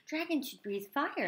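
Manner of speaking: insistent father. A narrator's reading. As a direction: press the point as a father. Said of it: "insisted Crispin's father".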